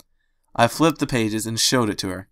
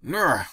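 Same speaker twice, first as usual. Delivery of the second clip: strained grunt; 5 semitones higher than usual. Acted. Straining at jar lid.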